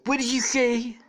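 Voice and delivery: high, loud, sharp challenge; hammed-up indignant presidential impression; Donald Trump. Trump challenges Gareth's remark, loud hammy impression. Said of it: "Trump is like".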